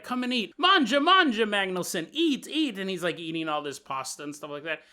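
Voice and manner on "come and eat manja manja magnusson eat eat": Italian-style host. Acted. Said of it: "they're like".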